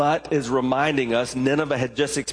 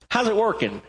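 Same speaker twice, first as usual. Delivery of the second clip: higher, breezy question; casual paraphrase; 7 semitones higher than usual. Acted. God casually asking Jonah about his anger. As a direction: toss the question off lightly. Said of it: "So it could have been".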